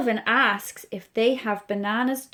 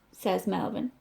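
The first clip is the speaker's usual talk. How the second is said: ordinary delivery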